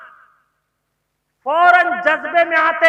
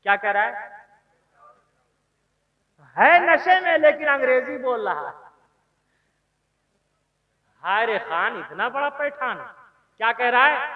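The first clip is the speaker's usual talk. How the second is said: ordinary talk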